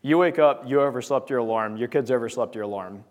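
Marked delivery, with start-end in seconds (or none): none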